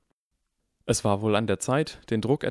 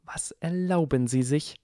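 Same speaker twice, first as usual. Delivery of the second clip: hiss; 8 semitones higher than usual. A narrator's reading. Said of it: "ihre Stimme wurde zu einem Zischen".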